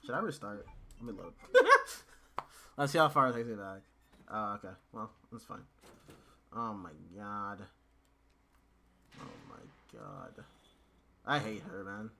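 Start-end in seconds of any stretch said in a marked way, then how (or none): 1.4-2.1 loud high burst of laughter; exasperated laugh. Real, not acted. Laughs at failure. 9.2-10.4 low, slow whisper; whispered exasperation. Real, not acted. Muttered in frustration.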